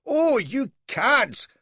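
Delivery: slow, high, loud "Oh"; young captain bawling at the slavers; outraged bawl. A narrator's reading. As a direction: drag out the insult in outrage. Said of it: "bawls Ballantyne".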